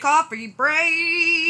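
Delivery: high, slow drawn-out call; excited cheer. Acted. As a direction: sing it out gleefully. Echoing the coffee break cheer.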